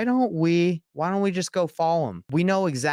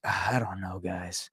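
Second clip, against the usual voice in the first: sheepish mumble; 8 semitones lower, 6 dB quieter than usual. Retold or imitated. Imitating worker caught lying.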